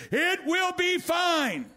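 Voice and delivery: slow, soaring divine reassurance; Jesus voice. Retold or imitated. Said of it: "Jesus comes walking in ... said".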